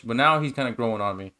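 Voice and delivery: ordinary talk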